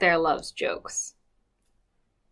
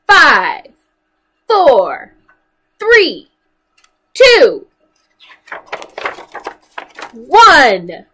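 loud countdown, each number punched; called countdown; 6 semitones higher, 10 dB louder, much slower than usual. Acted. Storyteller counts down loudly for the children. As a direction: call out the count like a launch.

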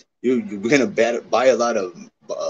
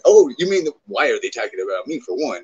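normal talking voice

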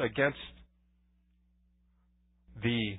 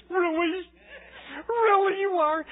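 high, loud squeal of surprise; mock amazement; 6 dB louder than usual. Speaker retelling his reaction to the Hungarian woman, hammed-up amazement. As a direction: squeal it, pitched way up in hammed-up disbelief.

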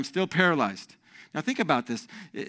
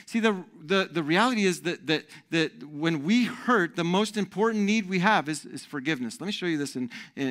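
ordinary delivery

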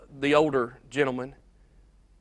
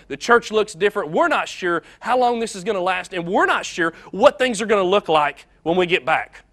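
louder, higher, preach it with conviction; rising preaching; 4 semitones higher, 7 dB louder than usual. Acted. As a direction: build to a confident declaration. Preaching climax.

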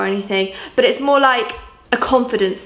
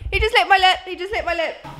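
laughing disbelief; 8 semitones higher than usual. Real, not acted. Vlogger retelling the lick to camera, laughing it off.

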